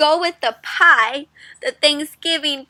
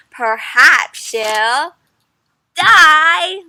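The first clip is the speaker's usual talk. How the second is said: dramatic refrain; 3 semitones higher, 7 dB louder, much slower than usual. Acted. Teacher reading the book's refrain with mock drama. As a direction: draw it out, then spring the last word.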